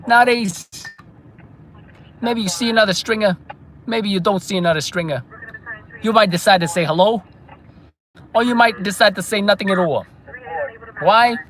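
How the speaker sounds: nostalgic Brooklyn wise guy; put-on Brooklyn accent. Stringer recalling respect back then, put-on Brooklyn accent. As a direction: lament the old days in Brooklynese. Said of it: "Brooklyn accent".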